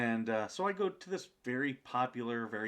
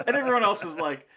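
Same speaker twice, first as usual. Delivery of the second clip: laughing through the words, louder; bursting into a laugh mid-sentence; 10 semitones higher, 11 dB louder than usual. Real, not acted. Laughing while telling story.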